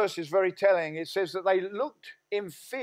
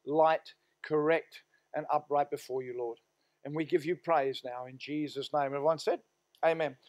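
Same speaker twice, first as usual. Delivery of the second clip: prayer; 2 semitones lower, 3 dB quieter than usual. Acted. Pastor prays before sermon.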